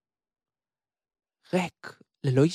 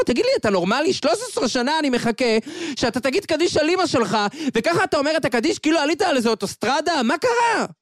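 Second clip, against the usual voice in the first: a father's outrage, higher and fast, cracking to a peak at the end; angry father scolding; 9 semitones higher, faster than usual. Acted. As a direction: berate your son for rushing the kaddish. Father scolds son over kaddish. (כעס של אב נוזף)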